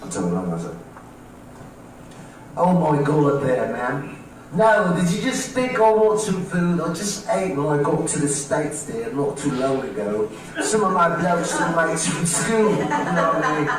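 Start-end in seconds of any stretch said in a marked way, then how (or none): 2.6-13.7 put-on British-accented indignant refusal. Man refusing a lady's offer of food, British accent. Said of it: "I decided to use one of my accents".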